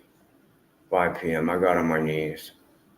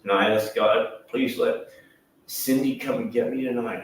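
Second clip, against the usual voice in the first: ordinary delivery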